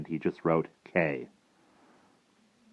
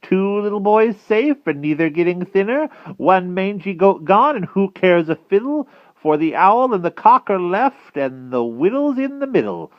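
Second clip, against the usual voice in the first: high, loud witch reciting a counting rhyme; Madam Mim; 9 dB louder than usual. A narrator's reading. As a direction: chant the tally in a witchy voice. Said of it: "counted Madam Mim".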